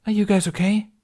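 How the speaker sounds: high, loud and anxious; a worried young woman calling out; Tooth's worried voice. A narrator's reading.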